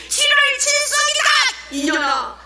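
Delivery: very high, loud acted scream; furious bellow. Cursing grandmother at the evangelist on ritual day, furious shriek. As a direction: scream the rebuke at the top of the voice. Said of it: "막 호통을 치면서는".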